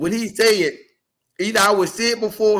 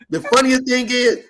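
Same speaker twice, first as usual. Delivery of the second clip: usual speaking voice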